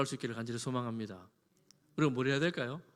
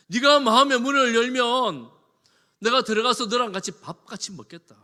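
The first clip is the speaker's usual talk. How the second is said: fervent pulpit shout; full-force preaching; 8 semitones higher, 13 dB louder than usual. Acted. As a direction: cry it out to the congregation. Preaching Christ's invitation at full force.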